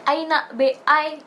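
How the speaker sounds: put-on playful Malaysian accent; Malaysian English. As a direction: imitate a Malaysian student saying it. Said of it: "kayak gitu".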